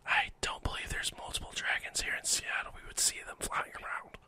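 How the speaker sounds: hushed disbelief; whispering. Acted. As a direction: whisper it doubtfully. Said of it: "why are you whispering".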